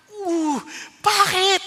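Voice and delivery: loud, high, drawn-out shocked "bakit"; startled cry. Retold or imitated. Husband reacting to his wife's refusal, shocked and loud. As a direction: burst out in disbelief.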